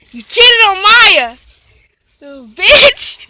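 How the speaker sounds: loud, slow taunting yell; shouting out. Real, not acted. Girl yelling from the car at a cheating boy, loud taunt. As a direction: drag it out loud to shame him. Calling out to Ronil.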